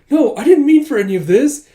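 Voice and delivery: high, loud desperate plea; hammed-up distress; frantic pleading. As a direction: plead innocence, flustered.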